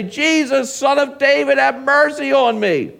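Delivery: high, loud plea for mercy; crying out. Acted. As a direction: cry out like a beggar calling over a crowd. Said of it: "he began to cry out".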